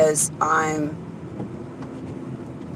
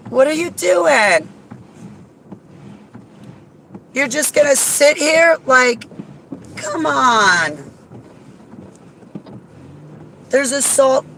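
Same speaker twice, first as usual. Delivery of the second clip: frustrated driver, higher pitched; exasperated; 6 semitones higher than usual. Real, not acted. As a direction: throw up your hands at the traffic. Stuck behind slow driver.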